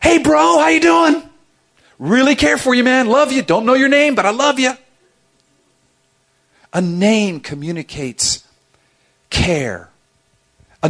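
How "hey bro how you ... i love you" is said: much higher, louder overeager buddy voice; mock bro greeting. [name] mocking a coworker greeting, higher louder gushing buddy voice. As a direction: gush over a guy whose name you forgot. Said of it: "He's like".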